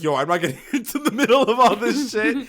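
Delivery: imitating a friend who won't get involved; laughing impression. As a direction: do the friend's voice while giggling. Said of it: "Alex is like".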